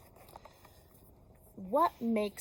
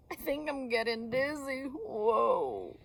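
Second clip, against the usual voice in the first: higher teary whimper; mock crying; 4 semitones higher than usual. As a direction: play a dizzy crying bird. Bonked Bird crying.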